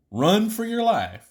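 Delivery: high, loud and slow; dramatic warning. Acted. Old pilot warning the trainee, mock dramatic. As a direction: ham up the danger for the trainee.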